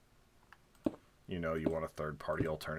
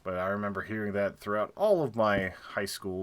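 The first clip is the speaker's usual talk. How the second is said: usual speaking voice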